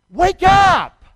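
high, loud shout of wake up; shouted call. Real, not acted. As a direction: shout it to rouse them. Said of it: "Say that with me".

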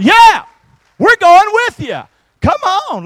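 high, loud jubilant shout; excited shout. Acted. Pastor rallying congregation, jubilant high shout. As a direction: cheer it out.

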